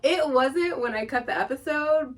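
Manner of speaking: ordinary talk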